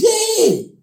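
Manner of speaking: squealed high cheer; very high-pitched little-girl hooray; Sherry. Retold or imitated. Sherry cheering Moe's show, high squeal. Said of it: "And Sherry goes".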